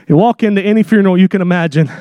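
normal talking voice

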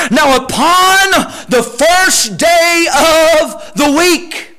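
slow preached verse. Acted. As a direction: drag every word out for the crowd.

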